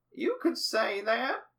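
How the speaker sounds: put-on high, slow sly old man; understated old man brag. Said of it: "Potter says something like".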